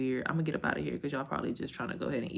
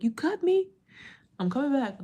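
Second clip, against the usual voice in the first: high squeaky spider voice; mock spider; 10 semitones higher than usual. The spider vowing to return, in a high mock voice. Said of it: "He like".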